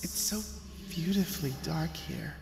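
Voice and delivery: put-on soft high eerie boy; dead boy. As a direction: savour the dark like a creepy child. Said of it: "he giggled".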